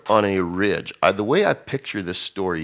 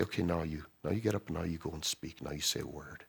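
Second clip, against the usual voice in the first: much softer, quick gentle instruction; soft commissioning; 12 dB quieter, faster than usual. Retold or imitated. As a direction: hush it like a quiet command. God sends him, softly.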